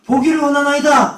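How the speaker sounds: loud, high plea called out; uitroepen. Acted. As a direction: cry it out like a petitioner, loud and high. Acts out Bartimaeus crying out.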